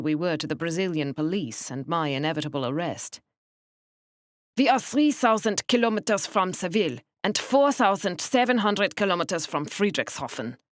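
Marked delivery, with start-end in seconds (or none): 4.6-10.6 put-on precise, stiff thick German accent. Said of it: "Her accent was the thickest I had encountered".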